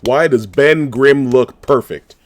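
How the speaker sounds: loud, slow amazed questioning; excited disbelief. Real, not acted. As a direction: drag it out in loud awe.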